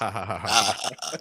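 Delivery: evil chuckle; hammy villain laugh; comic book villain laugh. Acted. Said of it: "Comic book villain laugh".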